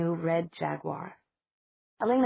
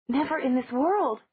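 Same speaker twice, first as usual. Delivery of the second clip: defiant refusal; 7 semitones higher, 4 dB louder than usual. Acted.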